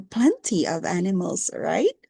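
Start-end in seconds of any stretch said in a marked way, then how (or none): none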